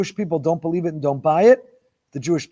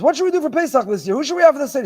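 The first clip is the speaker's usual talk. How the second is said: mimicking a chatty questioner, much higher and quick; a higher, breezy voice for the friend asking about the Seder; chatty asker; 10 semitones higher, 4 dB louder, faster than usual. Imitates someone pestering about Pesach.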